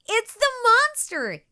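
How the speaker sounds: high, loud frightened cry; yelled. A narrator's reading. Two girls under the bed yelling in storybook fright. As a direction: shout the alarm together. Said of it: "yelled Katie and Jojo".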